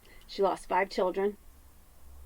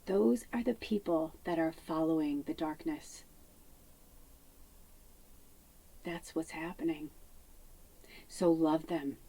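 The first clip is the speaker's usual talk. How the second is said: hushed; 3 semitones lower, 7 dB quieter than usual. Real, not acted. Sermon-like hushed message.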